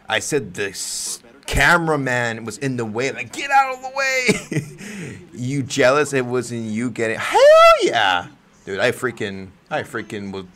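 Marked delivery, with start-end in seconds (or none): 3.3-5.2 high, drawn-out mock complaint; retold pissed yell. Fan telling a cameraman to move, high mock-angry squeal. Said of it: "I got pissed". 7.2-8.3 very high, loud whoop; excited agreement. Real, not acted. Admitting jealousy to a viewer, loud high whoop.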